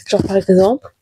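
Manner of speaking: ordinary delivery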